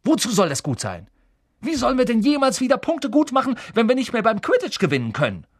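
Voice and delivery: fast, high, furious shouting; Wood thundering. Acted. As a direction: rail in exasperated disbelief. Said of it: "donnerte Wood".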